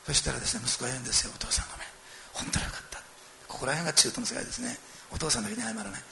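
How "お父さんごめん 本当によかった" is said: soft, breathy-free murmur of a sorry son; hushed apology. Retold or imitated. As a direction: say it under your breath, ashamed. (小声でしょんぼりと謝る息子)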